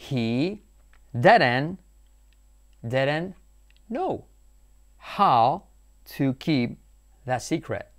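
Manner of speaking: slow, each word apart; He didn't know how to keep that secret, one word at a time; word-by-word dictation. Shown as a demonstration. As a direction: pause after every word. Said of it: "word-by-word slow dictation".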